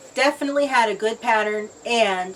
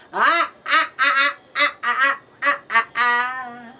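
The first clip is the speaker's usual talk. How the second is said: short, bouncy open-mouthed ah notes; funny sung "ah-ah"; 3 semitones higher than usual. Shown as a demonstration. Showing kids how to catch raindrops, staccato ahs. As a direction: pulse "ah" as if catching rain in your mouth. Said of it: "I need you to go".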